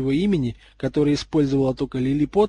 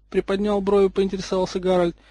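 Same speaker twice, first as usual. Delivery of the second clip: ordinary delivery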